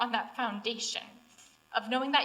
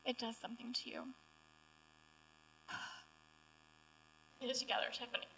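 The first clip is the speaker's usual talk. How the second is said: real tears, much softer; crying; 11 dB quieter, faster than usual. Real, not acted. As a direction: pulls herself together through tears. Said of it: "I can't talk and cry".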